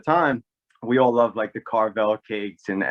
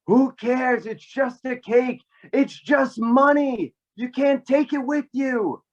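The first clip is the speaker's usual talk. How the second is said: high, drawn-out exasperated outburst; high-pitched imitation of an aunt losing patience; fed-up aunt; 11 semitones higher, slower than usual. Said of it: "she couldn't take this".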